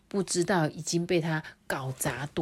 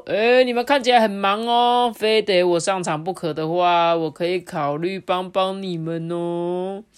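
smug offer; 5 dB louder than usual. Acted. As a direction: offer help grandly. Pot showing off.

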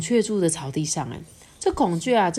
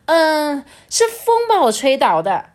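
higher, louder indignant excuse; huffy; 8 semitones higher, 7 dB louder than usual. A narrator's reading. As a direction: blame the wind in a huff. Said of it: "他气呼呼的说". (气呼呼地找借口)

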